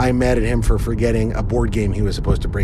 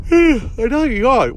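surprised; 12 semitones higher, 3 dB louder than usual. Real, not acted. Notices recording is off.

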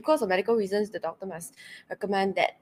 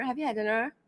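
ordinary talk, nothing marked

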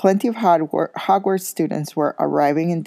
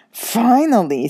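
excited greeting; 7 semitones higher, 5 dB louder, slower than usual. A narrator's reading. Ron greets Harry.

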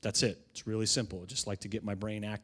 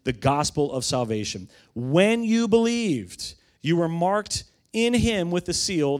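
emphatic scripture reading; 4 semitones higher, 5 dB louder than usual. Acted. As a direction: proclaim the verse to the congregation. Reads scripture aloud to congregation.

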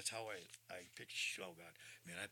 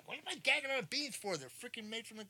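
exasperated; 14 semitones higher, 8 dB louder than usual. Real, not acted. Indignant outburst about beans.

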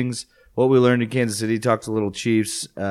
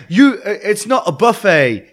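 high, loud mock British ad voice; lightly hammy British TV ad voice; commercial actress impression; 10 semitones higher, 9 dB louder than usual. Imitating a commercial.

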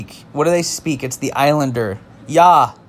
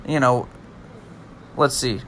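ordinary talk, nothing marked

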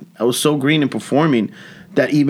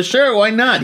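high breezy repeat of the shrug; casual shrug; 10 semitones higher, slower than usual. Retold or imitated. Comic repeating his shrug to the Sony man, breezy. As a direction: brush it off, light and high.

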